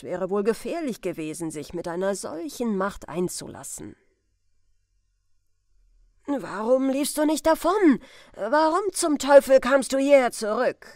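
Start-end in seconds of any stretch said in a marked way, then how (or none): 6.3-10.9 loud, higher furious questioning; accusing crowd voice; angry demand. Acted. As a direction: bark it at the prisoner. Crowd interrogating Potter.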